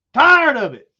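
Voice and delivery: high, loud mocking whine; mock him as a whiner; a hammed-up grumble; mock complaint. Mocking Hunt, louder higher.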